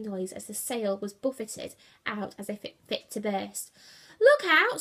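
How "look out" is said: high, loud scream of alarm; screamed warning. A narrator's reading. Brutus as the ropes snap, screamed warning. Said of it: "screamed Brutus".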